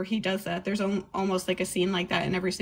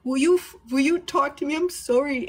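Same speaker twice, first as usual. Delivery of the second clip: higher, pleading mock apology; imitating dad's plea; 6 semitones higher than usual. Dad on the phone begging his daughter, mock pleading. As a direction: play the dad begging, pitched up. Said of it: "crying on the phone".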